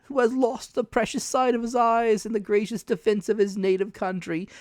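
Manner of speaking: high, slow, wheedling beggar's sing-song; a creepy high sing-song of a blind beggar; odd sing-song. Acted. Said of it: "raising his voice in an odd sing-song".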